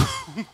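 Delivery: high squeal of a laugh, imitating his wife; imitated laugh. Retelling his wife laughing at the idea of Russia, imitated laugh. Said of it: "she started laughing".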